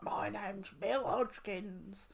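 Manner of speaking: slow, eerie possessed voice; creepy ghost voice. Retold or imitated. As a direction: drag it out like a ghost. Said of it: "the one where she's like".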